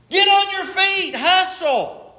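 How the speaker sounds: very high, urgent rallying cry; rousing coach's call. Acted. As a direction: call it out like a coach driving runners. Repeats command like a coach, escalating.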